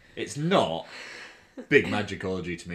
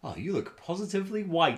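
mock condescending compliment; 10 semitones higher than usual.

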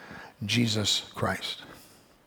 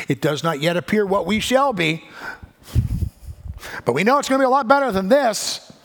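higher and louder, driving every phrase; preaching at full force; 10 semitones higher, 6 dB louder than usual. Acted. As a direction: preach it out, rising to a peak. Preaching climax to congregation.

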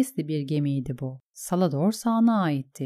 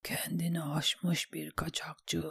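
slow, hushed near-whisper; inner thought; 10 dB quieter than usual. A narrator's reading. Davos silently answers who he is, in a hushed voice. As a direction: think it privately, barely voiced. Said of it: "diye düşündü Davos".

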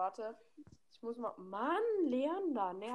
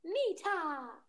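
imitating Nita; 6 semitones higher than usual. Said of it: "würde ich Nita nachmachen".

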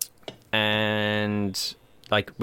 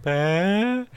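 put-on toddler; 9 semitones higher than usual. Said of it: "she's just like".

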